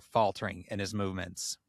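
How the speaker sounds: ordinary talk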